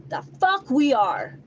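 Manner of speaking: slow, loud, scornful; indignant retort. Real, not acted. Angry retort.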